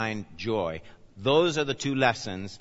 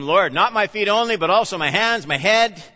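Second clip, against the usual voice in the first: eager Peter; 8 semitones higher, 7 dB louder than usual. Acted.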